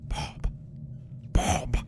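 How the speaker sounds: ordinary delivery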